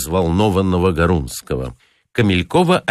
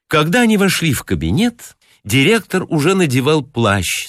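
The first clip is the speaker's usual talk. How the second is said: ordinary delivery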